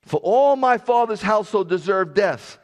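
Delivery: loud, high scriptural cry; impassioned declaration. Acted. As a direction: proclaim it forcefully to the king. Acted plea from scripture.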